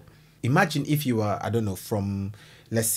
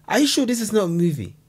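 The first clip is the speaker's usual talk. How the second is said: high, loud incredulous question; disbelief; 8 semitones higher, 4 dB louder than usual. Real, not acted. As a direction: ask it in total disbelief. Reacting in disbelief.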